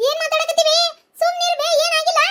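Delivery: put-on high childish voice hushing grandma. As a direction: play the small granddaughter. Child character voice.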